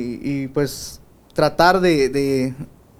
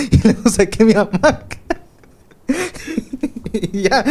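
higher, laughing through the words; 6 semitones higher than usual. Real, not acted. Telling how even the hammock went, laughing. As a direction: break into a laugh on the punchline. Punchline of own bedroom sacrifice story.

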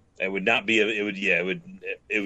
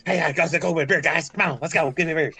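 Gary Busey impression; 8 semitones higher than usual.

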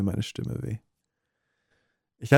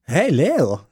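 loud, high, dopey character voice; dumb voice; 6 semitones higher, 6 dB louder than usual. Acted. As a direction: call out like a dim-witted kid. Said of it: "Das ist richtig dumm".

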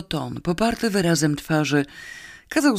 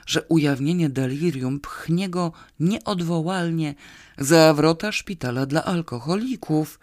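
usual speaking voice

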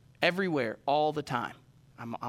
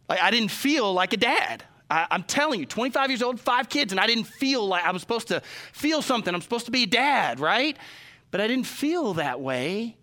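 choked up; 6 semitones higher, 4 dB louder than usual. Real, not acted. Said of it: "I can't talk about my kids without tearing up".

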